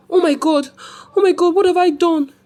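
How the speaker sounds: high, loud panicked outburst; horrified cry. Acted. Mother horrified at her own deed, panicked cry. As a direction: cry out in horror at what she did.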